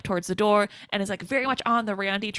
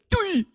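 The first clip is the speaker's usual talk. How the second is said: goofy grunt; 11 semitones higher than usual. Retold or imitated. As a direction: yelp like a clumsy killer hit in the face. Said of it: "he makes the funniest noises".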